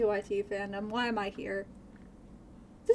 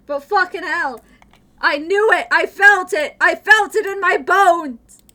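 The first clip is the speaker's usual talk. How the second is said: loud, high exasperated yelling; exasperated shout; 6 semitones higher, 16 dB louder than usual. Real, not acted. Streamer to chat about the song, yelling. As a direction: shout it, mock outrage at being caught.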